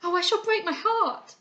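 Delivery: high, loud grieving wail; heartbroken cry. A narrator's reading. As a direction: let your heart break aloud. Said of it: "she cried".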